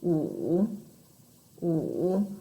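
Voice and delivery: "Wu", each dipping very low then rising; third tone, dipping. Shown as a demonstration. Teacher trying number five in third tone, low dip then up. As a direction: sink "Wu" to the bottom of the voice, then lift it. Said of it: "you should start very, very low".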